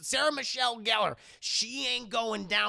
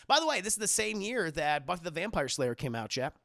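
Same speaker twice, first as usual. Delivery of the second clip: ordinary talk, nothing marked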